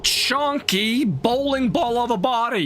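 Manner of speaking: high, drawn-out heckling; indignant rant. Real, not acted. Gamer insulting the enemy who knocked them down, indignant rant. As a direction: stretch the insults out in high-pitched outrage.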